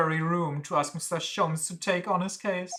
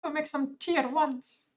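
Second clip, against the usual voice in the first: high-pitched order, a bit less extreme; bossy, high and demanding; high commanding voice; 12 semitones higher than usual. Acted. Character ordering someone to make tea, high and bossy. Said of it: "That's a bit better".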